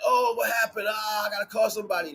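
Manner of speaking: high, loud, flustered after a fender bender; mock startled driver. Careless driver on the highway after a crash, mock flustered and loud. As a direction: play the careless driver who just got hit, caught off guard.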